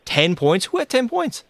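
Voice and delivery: squeaking way up high in amazement; stunned disbelief. Real, not acted. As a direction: gasp at an impossible stat. Reacting to a stat in chat.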